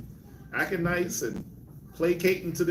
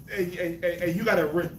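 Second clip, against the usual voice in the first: normal talking voice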